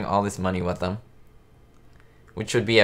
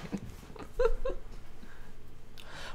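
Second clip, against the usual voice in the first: high laugh; 10 dB quieter than usual. Real, not acted. Laughing at a great line.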